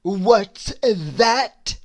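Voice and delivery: loud rude roar. A narrator's reading. Mister Uppity roaring at the host. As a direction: bark it out, offended. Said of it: "roared Mister Uppity".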